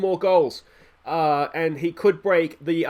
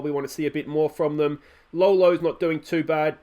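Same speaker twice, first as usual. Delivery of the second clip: normal talking voice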